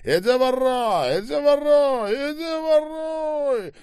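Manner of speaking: very high, wailing yells; frantic shouting. A narrator's reading. Скоба yelling over Ребров before grabbing the machine gun, screaming. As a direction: repeat it in rising, stretched screams. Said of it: "закричал Скоба".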